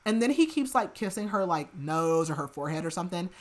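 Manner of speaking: ordinary talk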